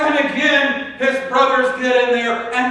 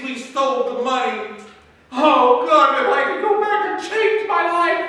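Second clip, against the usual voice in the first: anguished lament; 6 semitones higher than usual. Acted. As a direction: cry out to God in regret. Brothers' anguished cry to God.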